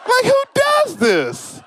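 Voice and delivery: high, slow, laughing incredulity; exasperated disbelief. Real, not acted. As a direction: ask it in stunned laughter.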